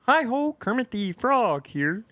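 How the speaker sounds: high, slow, nasal frog puppet voice; Kermit the Frog impression. Podcaster showing his Kermit for the host, high slow puppet voice. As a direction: squeeze it up into a cheerful puppet frog. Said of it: "I try and do a".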